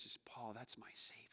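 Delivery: a hushed, breathy whisper; grandfather's soft whisper to his grandson. Retold or imitated. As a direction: lean in and whisper it like a secret. Grandfather's quiet words retold.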